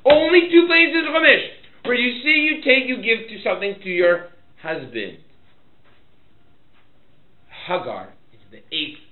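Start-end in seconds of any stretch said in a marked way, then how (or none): 0.1-5.2 loud, high proclamation; full-force preaching. Real, not acted. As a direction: drive the point home at full voice.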